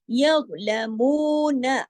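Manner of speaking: यग़लमून slow, मू held two counts, नून short; tajweed recitation. Shown as a demonstration. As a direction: draw out मू in यग़लमून. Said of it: "मू को दो हरकत खींचा लेकिन नून को सिर्फ एक हरकत". (मू को दो हरकत खींचकर यग़लमून)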